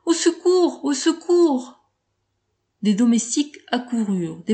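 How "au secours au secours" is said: high, slowing cries for help; terrified cries. A narrator's reading. Woman attacked in the dark, high terrified cry. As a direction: scream it out in terror. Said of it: "criait effroyablement".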